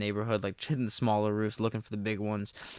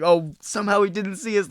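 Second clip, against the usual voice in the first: high, loud amazed exclaim; amazed friends; 10 semitones higher, 7 dB louder than usual. Retold or imitated. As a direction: pitch up in disbelief. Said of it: "they're like".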